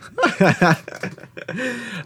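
burst of laughter. Real, not acted. Laughing at the plug.

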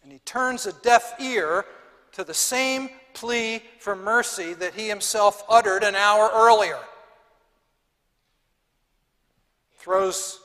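loud, rising preacher's indignation; preaching at full force. Acted. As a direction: hammer the injustice home to the congregation.

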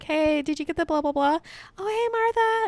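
high, chirpy greeting; friendly barista. Retold or imitated. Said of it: "They're just gonna be like".

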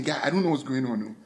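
ordinary talk, nothing marked

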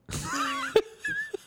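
laughing at own joke. Real, not acted. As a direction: crack up at the punchline.

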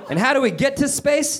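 high, lightly mocking question; almost mockingly. Three harpies asking how to travel, high half-mocking voice. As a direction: pitch it up, a touch of mockery. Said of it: "almost mockingly".

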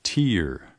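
the teacher offers tear rhyming with ear; option B. Shown as a demonstration. Teacher reading choice B, tear rhyming with ear. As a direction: tear said as /tir/ like the eye. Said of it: "or B".

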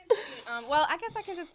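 laughing through tears; teary, half laughing; tearful laugh. Real, not acted. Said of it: "I'm crying".

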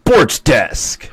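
hammed-up sports announcer catchphrase; sarcastic sports announcer. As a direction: boom it out like an overdone TV sportscaster. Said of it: "very overly sarcastic sports announcer".

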